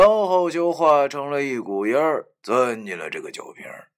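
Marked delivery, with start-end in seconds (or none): none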